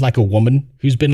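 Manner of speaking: normal talking voice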